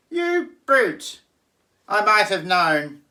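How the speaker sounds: very high, loud indignant piglet; outraged pig. Acted. Pig cries out at the wolf, high and outraged. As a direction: shriek the insult. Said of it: "Pig cried".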